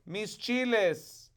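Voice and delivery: reading "Mis chiles" in Spanish; Spanish expression. Shown as a demonstration. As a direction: savour "Mis chiles" slowly. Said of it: "some Spanish expressions".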